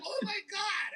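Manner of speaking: stunned excitement. Retold or imitated. He sees the comic himself.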